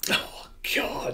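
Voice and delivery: breathless whisper; hushed, awed reaction; whispered oh God. Real, not acted. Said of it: "reader's hushed reaction".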